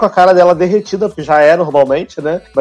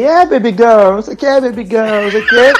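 debochada; 3 semitones higher than usual. Retold or imitated.

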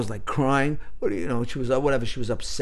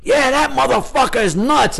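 retold outburst; 8 semitones higher, 4 dB louder than usual. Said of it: "he goes".

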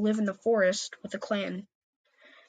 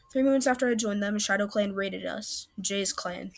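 plain reading voice

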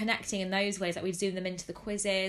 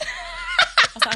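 high, loud burst of laughter; can barely get the words out for laughing; 11 dB louder, much slower than usual. Real, not acted. Telling her friend the lockdown story, cracking up.

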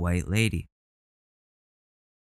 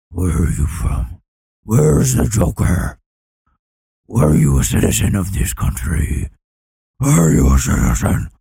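louder Batman-style grilling; mock Batman interrogation; 9 dB louder than usual. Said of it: "I'm Batman".

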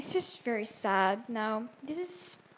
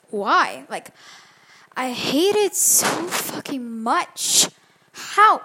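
loud, slow exasperated complaint; exasperated rant; 8 dB louder, slower than usual. Real, not acted. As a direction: rant like it truly hurts. Ranting about show deleted.

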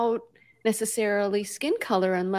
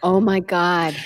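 ordinary talk

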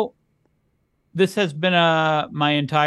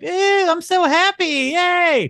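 much higher, slow, gushing mock cheer; overdone happy; 4 dB louder, slower than usual. Cohost mocking emotional people with a high, fake cheer. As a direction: gush with fake joy. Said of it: "We weren't always just like".

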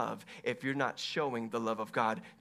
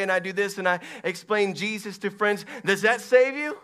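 loud, higher-pitched sermon delivery; full-force preaching; 6 semitones higher, 9 dB louder than usual. Real, not acted. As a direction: drive the questions home to the congregation.

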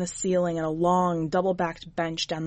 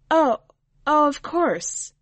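flustered; 8 semitones higher, 6 dB louder, slower than usual. A narrator's reading. Flustered, caught out by the witch.

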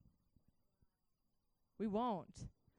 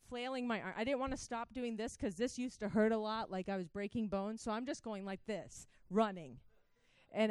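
ordinary delivery

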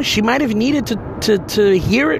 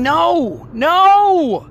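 a higher, louder cry of no; anguished denial; 9 semitones higher, 4 dB louder than usual. Retold or imitated. Refusing to believe his friend is at peace, crying out. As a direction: push back against the truth, pained.

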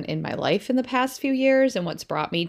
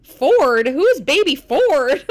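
loud, slow bewildered question; baffled child; 7 semitones higher, 9 dB louder, much slower than usual. Retold or imitated. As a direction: draw it out like a stunned kid.

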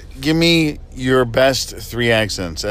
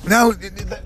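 higher put-on British vowels; mock British accent; 6 semitones higher, faster than usual. Shown as a demonstration. Host showing how to say game, mock British accent. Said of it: "that's how you, you say game".

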